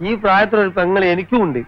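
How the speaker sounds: heated. Acted. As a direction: defend the teacher hotly. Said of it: "അതിന് താനെന്തിനാ ഇങ്ങനെ ചൂടാവണത്?". (ചൂടായി ശകാരിച്ചുകൊണ്ട്)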